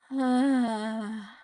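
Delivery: long, loud breath out; audible exhale. Retold or imitated. Patient signalling to nurses she's still awake, audible exhale. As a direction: push out a long sighing exhale. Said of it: "and I'd exhale".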